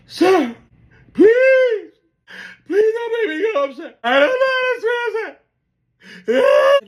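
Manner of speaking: very high, loud crying begging; imitating sobbing plea. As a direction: wail it like a grown man who is terrified of the water. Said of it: "he starts crying like a bitch".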